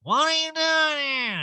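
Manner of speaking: high, stretched put-on voice; Erica impression. Erica confronting Jade, slow high mimicking voice. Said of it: "Erica's like".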